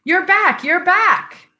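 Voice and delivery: high, loud joyful call from indoors; a boy yelling to his returning father; excited child shout. Acted. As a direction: shout it like a thrilled boy running to the door. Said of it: "Eugene shouts from inside".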